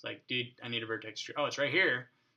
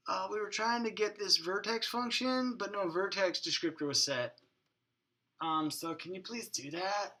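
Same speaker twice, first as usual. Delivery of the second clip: higher-pitched mock-polite voice of the computer; put-on complaining program; 6 semitones higher than usual. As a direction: play the program pleading in a raised voice. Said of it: "I shouldn't talk like that".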